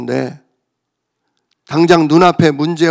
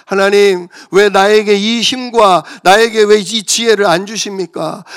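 raised, pleading cry to God; crying out in prayer; 4 semitones higher, 5 dB louder than usual. Retold or imitated. Pastor reliving his prayer to God, louder pleading cry. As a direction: plead loudly, higher, asking God why. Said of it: "하나님께 막 기도했어요".

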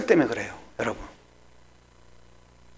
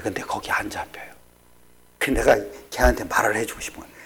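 whisper; 10 semitones lower, 7 dB quieter than usual. Acted. Whispered intimate sermon point.

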